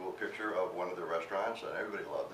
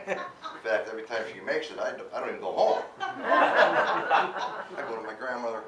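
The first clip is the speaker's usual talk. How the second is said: louder, laughing through the words; laughing refusal; 6 semitones higher, 8 dB louder than usual. Real, not acted. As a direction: giggle as you refuse. Laughing while refusing to call mom.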